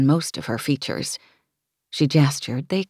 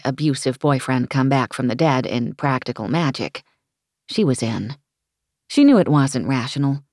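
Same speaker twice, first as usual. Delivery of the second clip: ordinary delivery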